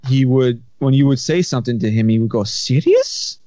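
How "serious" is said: put-on high, rising Egyptian-accented "Serious?". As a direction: play the Egyptian boss, incredulous and way up high. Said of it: "Pretty good Egyptian accent".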